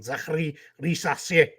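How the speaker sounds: goofy mock French accent on "Zachary Risacher"; "Zachary Risacher" with hammed-up French vowels; silly French pronunciation. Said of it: "when I'm being silly around the house".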